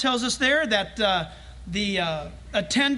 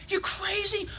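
mocking crowd; 8 semitones higher, 6 dB quieter than usual. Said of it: "They laughed him".

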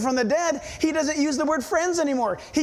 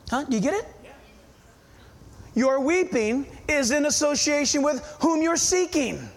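ordinary delivery

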